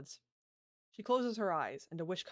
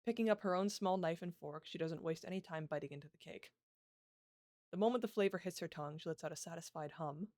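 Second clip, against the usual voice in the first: usual speaking voice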